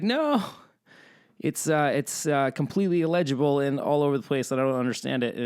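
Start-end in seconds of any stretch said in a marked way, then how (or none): 0.0-0.4 incredulous no. Retold or imitated. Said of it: "It's like".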